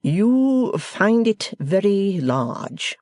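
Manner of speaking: host speaking grandly to the foreign guest, very slow; spacious Podsnap. Acted. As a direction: expand each word grandly. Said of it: "said Mister Podsnap spaciously".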